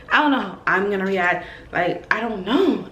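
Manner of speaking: normal talking voice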